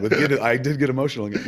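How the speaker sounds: usual speaking voice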